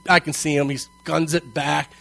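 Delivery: ordinary talk, nothing marked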